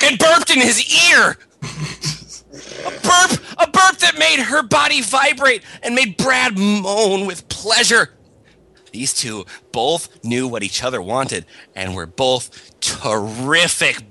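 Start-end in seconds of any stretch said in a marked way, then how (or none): 0.0-8.1 excited. A narrator's reading. Rising hammed reading.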